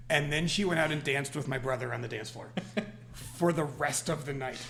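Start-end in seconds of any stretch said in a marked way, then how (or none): none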